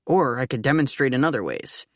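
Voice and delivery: ordinary delivery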